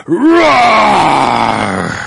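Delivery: big loud roar, forced out; booming lion roar. A narrator's reading. Lion showing his power to all: booming roar. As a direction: roar like a proud lion king. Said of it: "durch ein dröhnendes".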